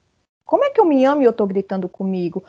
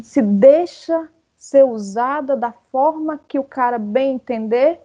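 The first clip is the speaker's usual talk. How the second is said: ordinary talk, nothing marked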